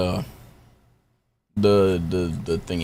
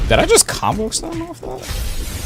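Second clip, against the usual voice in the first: surprised. Real, not acted. Astonished high pitch.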